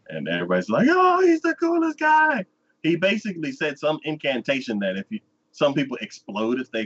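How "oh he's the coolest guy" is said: high, loud gushing; high, loud mock adoration; fawning crowd. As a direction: swoon over the hero.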